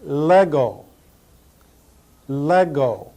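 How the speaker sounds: Lego as LEH-go, short e; Lego, crisp Greek vowels; Greek pronunciation. Shown as a demonstration. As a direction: Lego said as Greek, not the toy. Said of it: "Greek vocabulary drill".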